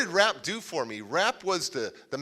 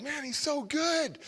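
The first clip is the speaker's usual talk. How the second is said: high, softer, drawn-out awe; admiring gush; 11 semitones higher, 6 dB quieter, slower than usual. Retold or imitated. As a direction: gush slowly like a starstruck fan. Retells gushing over his opponent.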